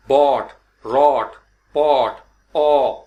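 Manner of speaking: बॉट and पॉट said slowly with lips rounded; rounded o drill. Shown as a demonstration. Teacher drilling the rounded o for students. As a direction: round the mouth on the o. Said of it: "मुंह थोड़ा सा गोल हो गया".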